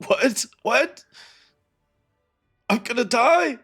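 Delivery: high panicked disbelief; a dying man hearing the news; alarmed dying man. Acted. Dying man hears he'll die.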